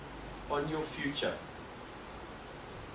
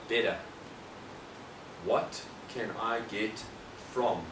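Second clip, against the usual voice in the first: ordinary talk, nothing marked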